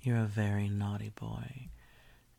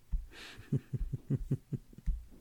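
teasing giggle; 4 semitones higher than usual. Acted. Teasing laugh.

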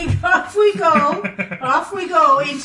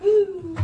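higher imitated owl call; owl hoot; 4 semitones higher than usual. Player supplying the owl sound, higher mock hoot.

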